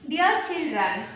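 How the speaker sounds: ordinary delivery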